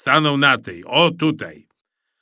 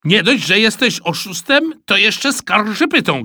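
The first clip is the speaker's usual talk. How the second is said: loud, high accusation; shouting; 10 semitones higher, 6 dB louder than usual. A narrator's reading. Boy calling his classmate a tattletale, shouting. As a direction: hurl an indignant insult. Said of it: "krzyknął Eusebiusz".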